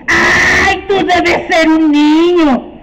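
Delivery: slow, high, syrupy witch voice; sugary witch. Acted. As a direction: coo at the child like a sweet old aunt. Witch greets child sweetly.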